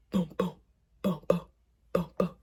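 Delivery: low, loud spoken boom boom heartbeat. Retold or imitated. Retelling her panic to viewers, pounding heartbeat sounds. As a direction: thump out each boom like a racing heart. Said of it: "my heart was like".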